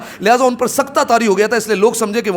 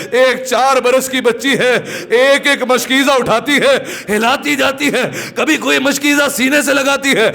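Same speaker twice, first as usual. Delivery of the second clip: raised, sustained lamenting delivery; mournful elegy recitation; 4 semitones higher than usual. Acted. Preacher tells mourners of a child seeking water, mournful recitation. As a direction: draw pity for the thirsty child.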